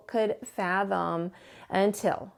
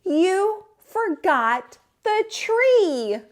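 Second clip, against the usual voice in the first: put-on high, slow, louder child's voice; 9 semitones higher, 5 dB louder, much slower than usual. A narrator's reading. Child telling Pop he forgot the tree, high and slow. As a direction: point out the mistake like a kid.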